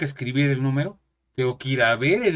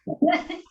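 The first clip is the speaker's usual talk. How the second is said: high burst of laughter. Real, not acted. Laughs at own joke.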